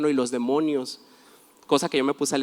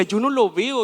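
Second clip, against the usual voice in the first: higher, innocent and wide-eyed; naive voice; 6 semitones higher than usual. Retold or imitated. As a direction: play the clueless one who sees nothing. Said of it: "Y yo decía". (voz ingenua y aguda)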